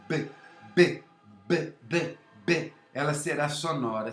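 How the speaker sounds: repeated voiced b, b, b; voiced b sounds. Shown as a demonstration. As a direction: demonstrate the voiced b with the vocal cords buzzing. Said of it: "a corda vocal funcionar". (demonstração do b sonoro)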